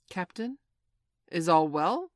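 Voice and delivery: hesitant query, lifting at the end; a touch of timidity; timid question. A narrator's reading. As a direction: ask the captain gingerly. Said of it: "they asked timidly".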